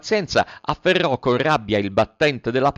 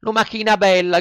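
higher, louder call up to the window; shouting puppet; 10 semitones higher, 4 dB louder than usual. A narrator's reading. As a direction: Pinocchio shouts to the snail from the street. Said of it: "gridò Pinocchio". (grido implorante)

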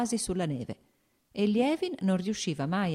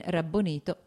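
usual speaking voice

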